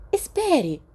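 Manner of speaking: high, loud call to stop; shout. A narrator's reading. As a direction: snap out a sharp Wait!. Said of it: "Lily gritou". (grito agudo e alto de espera)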